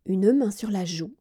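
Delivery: ordinary delivery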